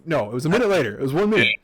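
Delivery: usual speaking voice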